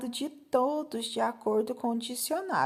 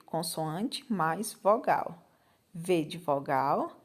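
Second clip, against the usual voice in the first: slow dictation; 5 semitones lower, slower than usual. Shown as a demonstration. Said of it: "teacher explains legend slowly".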